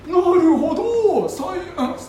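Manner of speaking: high, hammed-up Satsuma samurai voice ending in gowasu; 驚いた薩摩武士の誇張した声; Saigo Takamori. Saigo answers Ryoma in Satsuma speech.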